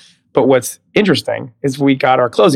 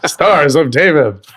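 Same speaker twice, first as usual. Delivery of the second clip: slow, drawn-out show title announcement; ice show announcer; 2 semitones higher, slower than usual. Acted. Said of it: "it sounds like an ice show".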